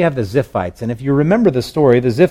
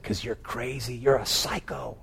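very soft, under the breath; hushed aside; 14 dB quieter than usual. Retold or imitated. Men doubting David's plan, muttered very softly. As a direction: mutter it almost inaudibly.

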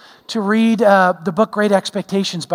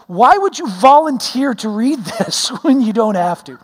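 higher-pitched, disbelieving retort to dad; incredulous son; 3 semitones higher than usual. Retold or imitated. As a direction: imitate a son baffled by his father. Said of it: "looking at me incredulously going".

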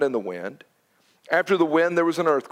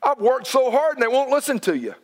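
angry Elijah; 9 semitones higher than usual. Retold or imitated. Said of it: "He was angry".

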